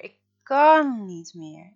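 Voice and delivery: drawn-out loud sobbing plea; wailing, breaking. A narrator's reading. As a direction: let it burst out, slow and loud. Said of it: "begint Freek te huilen".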